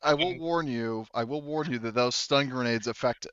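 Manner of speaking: ordinary delivery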